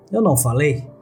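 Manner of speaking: ordinary delivery, nothing marked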